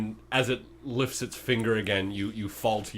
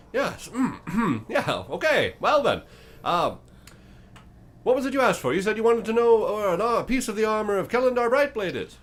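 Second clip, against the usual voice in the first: high, loud, oddly cheerful creature; a chipper monster eager to bargain; 14 semitones higher, 8 dB louder than usual. Acted. GM's bone creature answers the party, high and chirpy.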